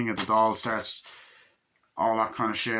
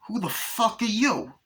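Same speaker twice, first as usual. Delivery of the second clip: high, slow menacing doll voice; Chucky voice; 11 semitones higher than usual. Retold or imitated. Chucky confronting the new doll, high menacing snarl. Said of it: "And Chucky's like".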